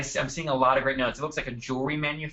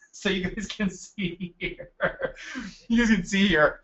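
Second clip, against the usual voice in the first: real laughter breaking into the words, pitched higher; laughing; 6 semitones higher than usual. Real, not acted. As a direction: try to carry on presenting through a laughing fit. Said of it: "I'm laughing 'cause I just splashed water".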